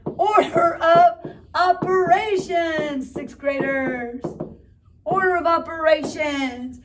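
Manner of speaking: slow, booming spooky announcement; spooky announcer voice. Acted. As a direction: boom it out like a horror movie.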